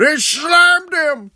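high, drawn-out roaring call; hammed-up play-by-play scream; mock commentator shout. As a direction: yell it like a historic slam. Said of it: "Hogan and Andre".

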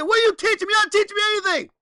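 high, loud whiny complaint; frustrated student. Retold or imitated. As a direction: play the fed-up kid protesting. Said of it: "He said".

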